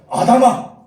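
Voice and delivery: booming, high-pitched shout of a name; loud call. Retold or imitated. God calling Adam in a loud voice, commanding shout. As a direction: call Adam out with full force. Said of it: "큰 소리로".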